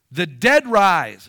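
slow booming declaration. Real, not acted. Pastor proclaims to believers that the dead rise, slow and loud. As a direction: land it like a verdict.

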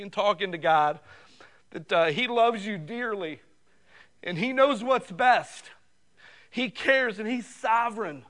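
high, impassioned preacher's build; fervent preaching. Acted. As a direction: preach it out with rising fire.

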